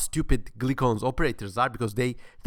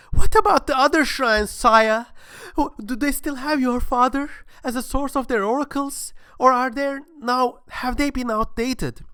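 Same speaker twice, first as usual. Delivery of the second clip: high, loud, eager questions; wide-eyed worshipper's pleading; gullible questioner; 11 semitones higher, 5 dB louder than usual. Acted. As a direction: ask the god like an honest believer. Believer questions the god.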